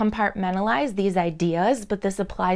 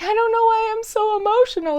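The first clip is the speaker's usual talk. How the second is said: high, quick imitation of someone overwhelmed; mock emotional wail; 14 semitones higher, 4 dB louder, faster than usual. Dream figure with tears coming out protests helplessly, imitated high and fast. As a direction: squeal it in a put-on, high-pitched voice. Voices tearful person in the dream.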